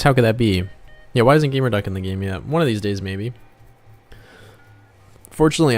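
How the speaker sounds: usual speaking voice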